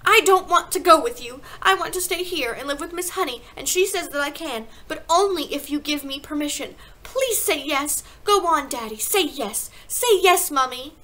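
put-on high, loud child's pleading; desperate little girl imploring her parents; bursting out. A narrator's reading. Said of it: "Matilda bursted out".

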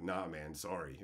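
low, soft retold brush-off; low-key refusal. A trader turning down another's request, low-key refusal. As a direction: imitate a quiet apology, pitched well down.

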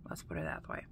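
sheepish whisper; confiding whisper about eating. Real, not acted. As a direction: whisper the admission.

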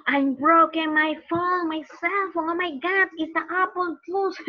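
high, whining mock distress; acted upset. Teacher acting upset over a broken cell phone. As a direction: wail over it in hammed-up dismay.

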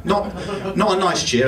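usual speaking voice

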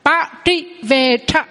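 slow ba trị về tha, each syllable apart; slow model reading. Shown as a demonstration. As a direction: read ba trị về tha slowly for students. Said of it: "À, là ha".

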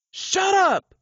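high, furious yell at the woman; angry shout. Acted. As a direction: bark it like a panicked gunman. Gunman threatening a woman.

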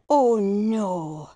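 ordinary talk

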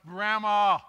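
put-on loud, high toddler yell for grandma. Grandpa imitating the toddler calling loud and high for grandma. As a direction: holler it from the crib. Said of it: "Then he starts".

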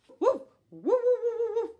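loud high woofs; puppy barking. A narrator's reading. Puppy barking at the kittens, loud high woofs. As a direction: bark like a playful puppy. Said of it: "the puppy went".

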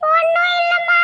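very high, whiny tearful voice; squeaky, plaintive daughter; tearful denial. Acted. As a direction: deny it through childlike whimpering. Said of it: "ஏன் இன்னும் அழுதுட்டு இருக்கம்மா".

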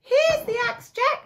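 high, loud, hurried mother; mother's reply. Acted. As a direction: thrust it at him in a rush. Mother hands the axe.

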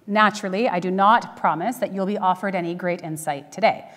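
ordinary talk

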